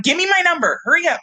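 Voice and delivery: high, urgent demand; rushing to make the race; frantic rush. Retold or imitated. Racer demanding her number, frantic and urgent.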